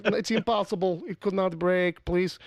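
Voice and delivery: hammed-up Russian denial, heroin starts hard K H; Russian accent. Host imitating Soviet official denying blame, Russian accent. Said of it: "I'm just doing Russian accents for no reason".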